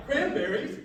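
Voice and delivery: a rallying call for them to echo; gusto. Shown as a demonstration. Host cues the crowd to shout the name, with gusto. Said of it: "with a little bit of gusto".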